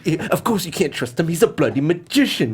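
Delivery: hammed-up British accent; shaky British accent attempt. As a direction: deliver a film line in put-on British. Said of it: "I can't do British very well".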